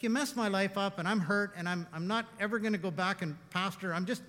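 preacher replaying his rant at God, heated; little rant. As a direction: vent it like a hurt man fed up. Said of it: "let me have my little rant".

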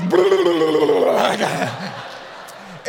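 loud wet raspberry, a trapped air burst; mock fart noise. Pastor imitating pants releasing air in the tank: loud raspberry. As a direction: blurt out the air escaping from his pants. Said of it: "And it goes".

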